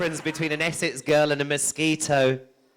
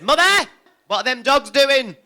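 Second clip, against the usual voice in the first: put-on high, loud little-boy voice; high loud child asking mum; child's voice; 11 semitones higher, 9 dB louder than usual. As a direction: ask like a curious small boy.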